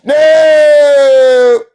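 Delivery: real shouted refusal. Real, not acted. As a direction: slam the claim down hard. Shouting down the mocked claim.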